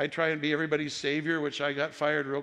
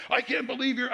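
indignant caller; 10 semitones higher, 4 dB louder than usual. Retold or imitated. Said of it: "He said".

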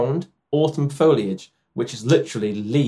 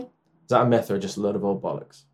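attempted Irish accent; 5 semitones lower, 8 dB quieter, faster than usual. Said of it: "help you with the Irish accent".

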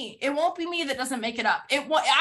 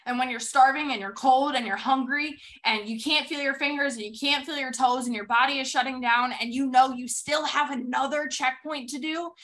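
ordinary talk

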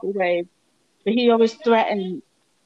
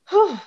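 shaky sigh; 9 semitones higher, 4 dB louder than usual. Real, not acted. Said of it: "I'm trying not to cry".

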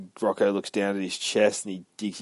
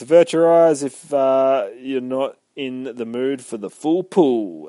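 loud announcement; 4 semitones higher, 8 dB louder than usual. Retold or imitated. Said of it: "He goes".

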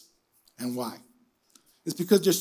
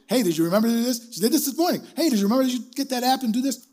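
higher-pitched imitation of a reminding wife; mock wife voice, pitched up; imitated nagging wife; 6 semitones higher, much faster than usual. Wife reminding a forgetful husband, imitated nagging.

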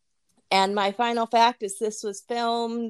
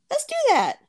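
excited suggestion; 4 dB louder than usual. Retold or imitated. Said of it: "And I said".

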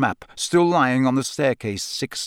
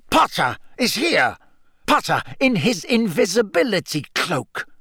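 rising from measured to high and insistent; snarling accusation; 6 semitones higher than usual. A narrator's reading. Snape exposing Potter's hidden presence, snarling accusation. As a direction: build the accusation, pitch climbing as certainty grows. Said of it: "Snape snarled".

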